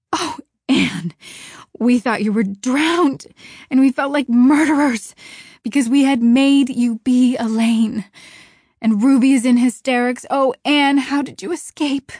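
high, loud, overwrought relief; tearful relief. A narrator's reading. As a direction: pour it out, half weeping. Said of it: "weeping with relief and delight".

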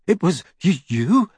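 shivering, stammering with cold; chattering teeth, weak voice. A narrator's reading. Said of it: "his teeth chattering".

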